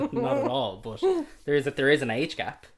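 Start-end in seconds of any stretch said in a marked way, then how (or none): 0.0-1.0 laughing. Real, not acted. Laughing denial of age joke.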